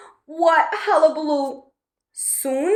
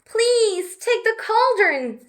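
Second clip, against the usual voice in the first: put-on higher, slow pleading girl; Betty's voice; 7 semitones higher, 3 dB louder than usual. Betty asking the dragon to take the cauldron, higher and slow. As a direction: ask gently and slowly, a little higher. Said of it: "said Betty".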